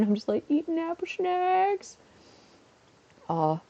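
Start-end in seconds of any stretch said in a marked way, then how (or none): 0.5-2.0 high, drawn-out silly voice; mock your own goofy, slow delivery. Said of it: "really stupid clips of me".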